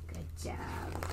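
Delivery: unvoiced whispered praise.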